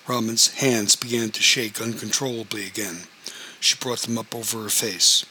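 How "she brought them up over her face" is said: plain reading voice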